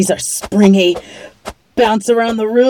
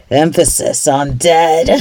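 normal talking voice

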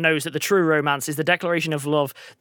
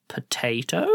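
low, soft, hesitant query; quiet questioning deadpan; puzzled algorithm; 5 semitones lower, 5 dB quieter, slower than usual. Retold or imitated. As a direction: imitate a machine guessing doubtfully. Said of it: "who it went".

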